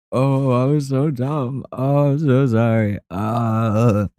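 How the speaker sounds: slow, high, drawn-out whine; hammed-up blubbering kid; mock crying apology. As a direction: wail the apology in pretend tears.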